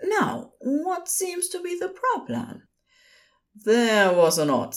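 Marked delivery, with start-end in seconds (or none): 0.0-2.7 high, composed nurse voice; even-tempered, high-pitched professional; level tone. A narrator's reading. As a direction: stay polite and even in a higher voice. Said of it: "Aziraphale kept his own tone level".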